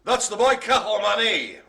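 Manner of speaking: loud, high cry of success; triumphant call-out. Real, not acted. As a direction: belt it out, having finally got it. Finally lands the hard breed name.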